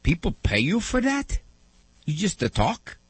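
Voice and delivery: lightly voiced old Italian man in disbelief; Italian accent. Grandfather amazed people pay for talk, Italian accent. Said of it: "in his broken Italian accent".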